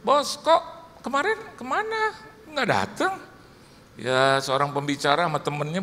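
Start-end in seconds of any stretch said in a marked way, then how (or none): 0.1-3.1 teasing greeting. Retold or imitated. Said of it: "saya tegur dia".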